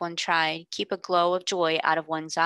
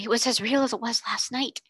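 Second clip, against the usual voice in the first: whispered excitement; 5 semitones higher than usual. A narrator's reading. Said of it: "she whispered excitedly".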